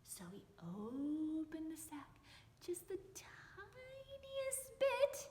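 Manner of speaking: whispered, slow and creeping; suspenseful whisper. A narrator's reading. Narrator telling the man's sneaky peek, slow whisper. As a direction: hush it like a secret.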